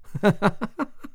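short high giggle; high teasing laugh. Acted. Mum laughing at Bella's story, a high giggle. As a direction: laugh at the joke.

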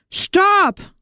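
high, loud wolf yell; sudden high-pitched shout; roar. A narrator's reading. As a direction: bellow it out as the alarmed wolf. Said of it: "brüllte der Wolf".

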